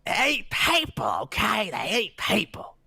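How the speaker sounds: high strained whisper shout; whisper yelling. Retold or imitated. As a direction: hiss it out like a shouted secret. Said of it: "Danny DeVito's just whisper shouting at us".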